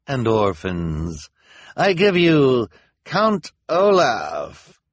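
slow, grand presenting voice; Officer Luciana, slow. A narrator's reading. Luciana unveiling the prisoner, slow and grand. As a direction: unveil the prize slowly. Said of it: "Officer Luciana said".

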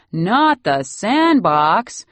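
loud, drawn-out mock horror; mock alarmed cry. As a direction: cry it out slowly in exaggerated panic. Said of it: "with a cry of".

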